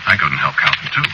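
ordinary talk, nothing marked

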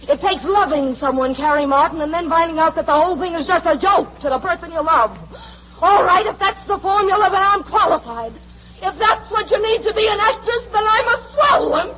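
anguish building to a high-pitched peak; bitter outburst. Acted. Star's bitter outburst at Carrie.

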